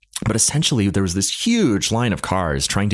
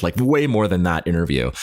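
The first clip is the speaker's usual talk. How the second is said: ordinary talk, nothing marked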